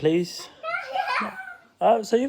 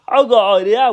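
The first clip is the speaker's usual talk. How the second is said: ordinary delivery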